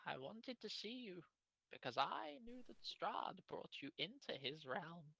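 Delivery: put-on high, soft old-woman voice; old fortune teller. Old woman explains herself to the adventurers, high and soft. Game master plays Madame Eva.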